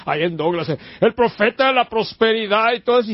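imitando con burla a sus críticos, voz más aguda; burla de los críticos. Retold or imitated. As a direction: remeda el reproche de los detractores. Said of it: "mis críticos siempre me han criticado". (cita burlona de los críticos, algo más aguda)

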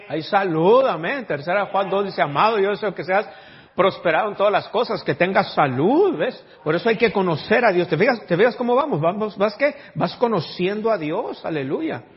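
loud, fervent pulpit preaching; preaching at full force. Acted. Pastor preaching loud to the church. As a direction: preach it out to the congregation.

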